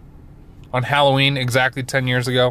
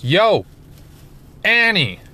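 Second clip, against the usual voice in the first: calling out; 7 semitones higher, slower than usual. Retold or imitated. Calling Annie in the plan.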